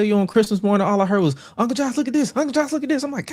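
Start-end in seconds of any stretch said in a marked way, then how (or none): 1.6-3.0 put-on higher nagging kids; mock kids calling. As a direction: pester uncle like excited kids. Kids calling uncle on Christmas.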